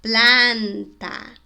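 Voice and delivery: "Planta" loud, with a long open ah; wide open A. Shown as a demonstration. Teacher showing it to students, emphatic open vowel. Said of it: "vou deixar assim bem aberto".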